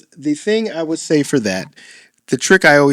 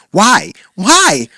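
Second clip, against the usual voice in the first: mock whiny kid, pitched up; annoying kid's why; 4 semitones higher, 4 dB louder than usual. Shown as a demonstration. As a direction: pester with repeated whys, higher each time. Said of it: "That tone is terrible".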